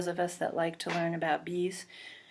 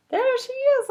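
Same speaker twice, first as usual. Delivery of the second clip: excited; 4 dB louder than usual. Acted. Reader points out Honey.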